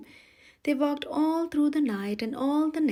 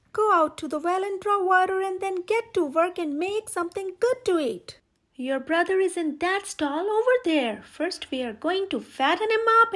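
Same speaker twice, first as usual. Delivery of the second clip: high, loud, harsh witch's yell; 6 semitones higher, 5 dB louder than usual. A narrator's reading. Witch shakes Gretel awake, yelling high and loud. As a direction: bark orders like a cruel hag.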